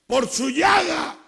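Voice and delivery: loud, high preacher's cry, pressing each phrase; full-force preaching. Acted. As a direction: preach it hard to the congregation. (predicación a todo pulmón)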